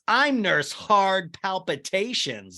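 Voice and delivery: high, drawn-out seductive nurse act; hammed-up nurse come-on; mock sexy nurse. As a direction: imitate a flirty role-play introduction. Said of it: "like, very sexy".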